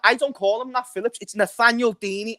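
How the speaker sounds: ordinary talk, nothing marked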